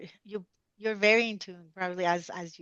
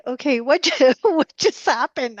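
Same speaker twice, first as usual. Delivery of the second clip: amazed disbelief; 7 semitones higher, 10 dB louder than usual. Retold or imitated. Retelling her own amazement.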